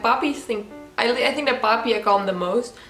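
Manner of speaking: usual speaking voice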